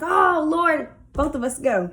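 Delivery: loud, high pretend cry while falling; mock alarm. Girlfriend pretending to fall off the cliff with boyfriend, mock alarm. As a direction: call out in hammed-up dismay.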